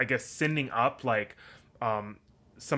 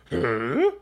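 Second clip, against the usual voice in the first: loud baffled huh; confused huh; 8 dB louder than usual. Pretend. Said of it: "I'm gonna go".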